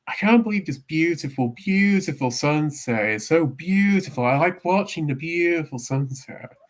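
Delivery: high, gushing over the sunset; Joey impression. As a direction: swoon over every beautiful. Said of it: "Joey is just sort of going".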